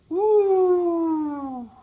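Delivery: high falsetto woo like an owl; high owl hoot. Shown as a demonstration. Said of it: "and go".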